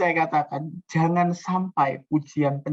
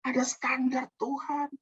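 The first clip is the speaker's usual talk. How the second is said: whisper; 7 semitones higher, 5 dB quieter than usual. Real, not acted. Preacher drops to whisper.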